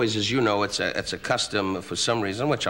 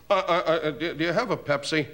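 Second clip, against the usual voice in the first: hesitant stammer, pitched up; Bob Newhart; 7 semitones higher than usual. Retold or imitated. Comedian imitating a fellow comic ordering, hesitant stammer. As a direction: stumble timidly into a simple request. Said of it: "Bob Newhart, who's a wasp from the Middle West".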